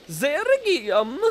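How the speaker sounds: put-on slow, drawn-out Tusk-style accent. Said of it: "that accent was".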